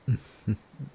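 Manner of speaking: short loud amused laugh; a pleased little laugh; vampire laughing at her enjoying prey. Acted.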